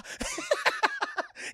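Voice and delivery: loud, very high laughing fit; shrieking cackle. Real, not acted. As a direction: burst out laughing uncontrollably. Cracks up at impression.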